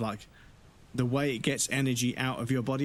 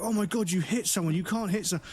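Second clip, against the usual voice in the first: put-on high, scandalised kid voice; shocked classmates; 7 semitones higher than usual. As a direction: gasp it like a tattling kid.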